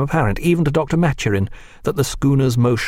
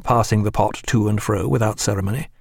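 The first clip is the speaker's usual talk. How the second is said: ordinary delivery, nothing marked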